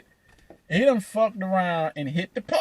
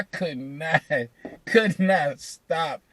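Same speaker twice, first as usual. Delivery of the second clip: laughing; much slower than usual. Real, not acted. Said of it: "I had the most horrendous laugh".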